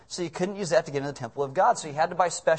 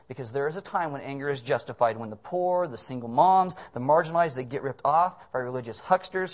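ordinary talk

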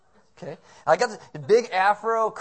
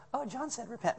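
mild; 6 semitones higher, 9 dB quieter than usual. Shown as a demonstration. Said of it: "No, he's like".

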